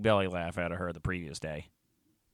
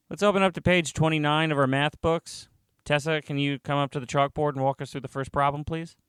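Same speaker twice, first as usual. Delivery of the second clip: higher-pitched classroom voice; teacher giving instructions; 7 semitones higher than usual. Acted. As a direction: address pupils across the room.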